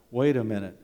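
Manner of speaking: ordinary talk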